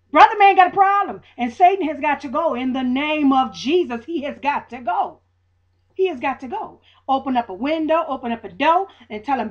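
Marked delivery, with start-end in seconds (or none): 0.1-6.8 louder, rising plea to God; mild retold church cry; fervent retold prayer. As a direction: call out to God like a preacher. Praying aloud to God, retold.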